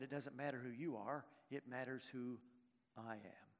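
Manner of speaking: quiet and low, fading to almost nothing; God tells Moses who matters, sinking into a hush. Acted. God reassures Moses in a soft, low, fading voice. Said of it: "God said".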